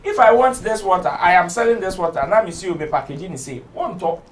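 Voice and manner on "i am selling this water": ordinary talk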